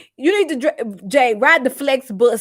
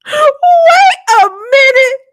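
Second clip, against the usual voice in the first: very high, loud and drawn out; stunned outburst; 8 dB louder, slower than usual. Real, not acted. Host halting the show over gossip, high squealed shock. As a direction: squeal it slowly in sheer disbelief.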